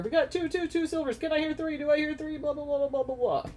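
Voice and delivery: fast rising auctioneer patter. Pretend. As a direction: rattle off bids like an auctioneer. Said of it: "You're like an auctioneer".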